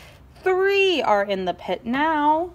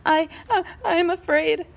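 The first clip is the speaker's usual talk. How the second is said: small, high, timid voice; nervous little pencil; scared Pencil; 6 semitones higher than usual. Acted. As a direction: quiver like a frightened kid.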